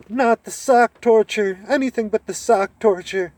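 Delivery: mock whimper of a cowed slave; cowering slave imitation. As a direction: cringe and beg like a beaten-down servant. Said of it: "did his beaten-down slave imitation".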